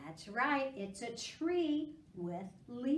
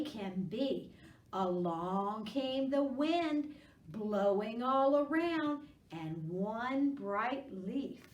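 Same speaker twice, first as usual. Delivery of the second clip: reciting a rhyme; 4 dB louder, slower than usual. Real, not acted. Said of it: "a little rhyme for us".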